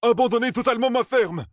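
very high-pitched outburst of protest; outraged roar. Acted. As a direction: explode in disbelief, pitch shooting up. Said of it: "rugit Flan".

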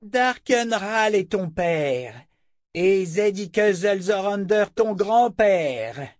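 high, slow proclamation; seer's vehement revelation, drawn out; crying out. A narrator's reading. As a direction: hammer out each name in a high voice. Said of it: "cria Chota".

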